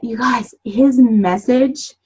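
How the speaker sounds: usual speaking voice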